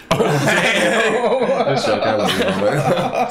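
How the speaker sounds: laughing, drawn-out take-back; chuckling apology to a friend. Real, not acted. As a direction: laugh it off slowly. Takes back an insult laughing.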